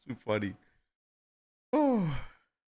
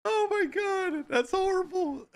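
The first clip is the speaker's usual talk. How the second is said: high, slow, half-crying through laughter; laughing. Real, not acted. Viewer reacting to funny photo, crying with laughter. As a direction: gasp it out while breaking up laughing.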